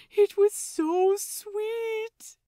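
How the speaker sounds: high, very slow, swooning; gushing. Acted. Lucius swooning to the crew, gushing. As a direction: gush over it dreamily. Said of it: "Lucius gushes".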